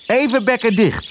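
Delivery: hoge kraaienstem die orde eist; roepende kraai. A narrator's reading. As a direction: roep hoog boven het gekwetter uit. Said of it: "riep Kwark boven alles uit". (hoog roepende kraai)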